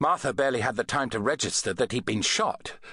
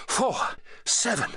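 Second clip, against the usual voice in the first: panting; 9 semitones higher than usual. A narrator's reading. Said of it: "she panted".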